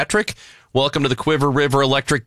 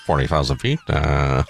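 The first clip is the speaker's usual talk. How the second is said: pilot radio voice; 8 semitones lower than usual. Acted. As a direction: mumble it like a captain on the intercom. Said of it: "how come everybody sounds like".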